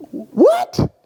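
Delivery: high, disbelieving what; stunned rising what; incredulous what. Retold or imitated. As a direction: jump way up in sheer disbelief. Stunned by turtle request.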